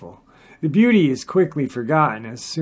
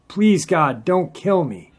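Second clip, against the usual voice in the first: understated scream, higher and louder; restrained cry of pain, pleading; 5 semitones higher, 5 dB louder than usual. A narrator's reading. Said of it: "I screamed out in the pain".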